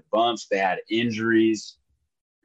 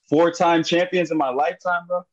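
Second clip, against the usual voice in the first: excited; 8 semitones higher than usual. Real, not acted. Fan celebrating his team's title.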